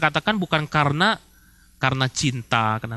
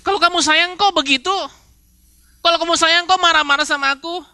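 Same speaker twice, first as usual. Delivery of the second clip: higher, reproachful wife's whine; a higher, hurt reproach from a complaining spouse; whiny spouse complaint; 8 semitones higher than usual. Acted. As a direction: nag him for not loving you right. Spouse complains during a quarrel.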